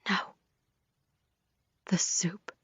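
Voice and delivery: horrified whisper. A narrator's reading. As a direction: breathe it out in dread. Realizes she was poisoned.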